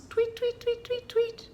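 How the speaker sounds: chirpy high tweet tweet; high bird tweets. Shown as a demonstration. Librarian shows children a bird sound, high chirping. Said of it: "make all kinds of bird sounds".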